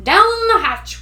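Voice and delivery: slow, loud group toast; crew shout. A narrator's reading. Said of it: "we all shouted".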